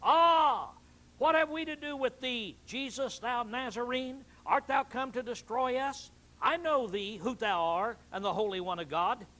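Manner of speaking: high, loud demon cry; loud shrieking demon voice. Acted. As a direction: scream it out as the possessed man's demon. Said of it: "he cried out with a loud voice".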